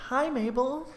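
very high, put-on hello; sing-song imitation of uneasy guests; mock awkward greeting. Everyone greeting Mabel, high awkward mock voice.

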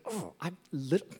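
small quiet David voice. Retold or imitated. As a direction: shrink the voice down like a little shepherd. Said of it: "He's like".

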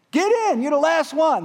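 high, full-volume rescuer's yell; urgent acted order; calling out. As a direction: shout down over a helicopter. Helicopter rescuers calling to victim.

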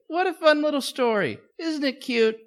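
high, bright mock delight; sarcastic mock cheer. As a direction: gush over it with sarcasm.

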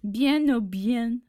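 slow, loud try at Marseille French; learner's drawn-out Marseille accent; attempted Marseille accent. Shown as a demonstration. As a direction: copy Marseille accent, stretching each word.

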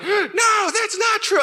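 very high-pitched strained shout; hammed-up high screech after a gasp; mock screamer. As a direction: act out a screaming spouse. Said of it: "Then there's just the screamer".

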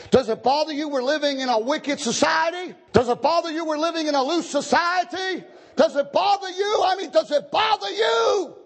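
high, rising pulpit shout; challenging preaching. Real, not acted. Preacher challenges congregation with questions.

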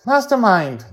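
put-on high, loud child calling out; alarmed young Joy. A narrator's reading. Girl calls to her frozen robot friend, high and alarmed. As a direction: call his name in a high kid voice.

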